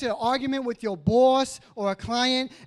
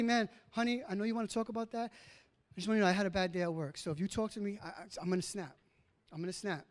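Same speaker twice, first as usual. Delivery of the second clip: calm warning; 5 semitones lower, 10 dB quieter, faster than usual. Retold or imitated. Calmly warning wife after bad day.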